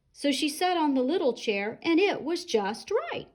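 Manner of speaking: usual speaking voice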